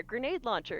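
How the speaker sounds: ordinary delivery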